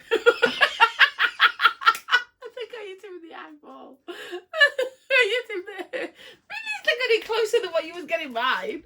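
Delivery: can barely speak for laughing. Real, not acted. Throwing game, hit in eye.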